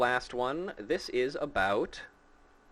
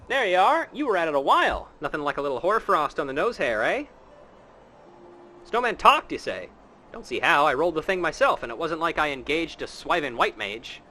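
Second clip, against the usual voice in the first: hearty townsman voice; 2 semitones higher, 4 dB louder, faster than usual. Acted. Streamer voicing villager scolding the snowman-talker, hearty. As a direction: play a blustery villager teasing a friend.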